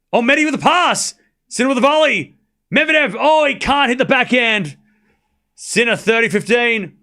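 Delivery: excited live tennis call. Real, not acted. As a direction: get swept up in the point.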